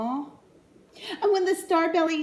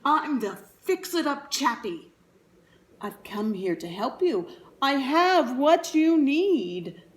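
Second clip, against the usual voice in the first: clear, keen salesman; 4 dB louder than usual. Acted. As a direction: sell it like a traveling huckster. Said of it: "he announced in a voice clear and keen".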